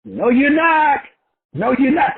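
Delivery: high, loud contradicting jab; mocking inner critic, high and loud; nagging inner voice. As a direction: shoot him down. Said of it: "the little voice saying".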